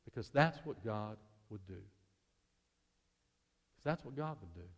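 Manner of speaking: low, soft and tender; tender answer. Acted. Husband answering his wife's why, low and tender. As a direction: hush it like a promise.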